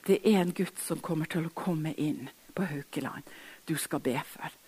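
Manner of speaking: soft whisper of God's word; hushed retelling. Retold or imitated. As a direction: hush as if God speaks in your ear. God's voice retold softly.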